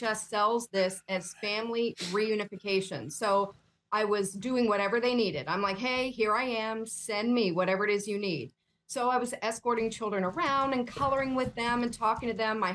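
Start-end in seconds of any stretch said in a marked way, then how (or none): none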